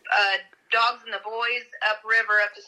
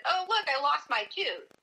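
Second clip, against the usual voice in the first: joking; 6 semitones higher than usual. Retold or imitated. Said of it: "kind of jokingly".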